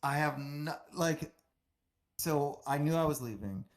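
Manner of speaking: ordinary delivery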